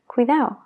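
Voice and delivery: "cuidado" said as cuidao, the last d gone; dropped d in cuidado. Shown as a demonstration. Teacher shows the casual Spanish cuidao. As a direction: swallow the d in "cuidado". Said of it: "a veces se omite".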